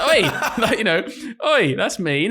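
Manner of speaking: mock offended. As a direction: protest as if offended, only joking. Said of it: "I was pretending to be offended".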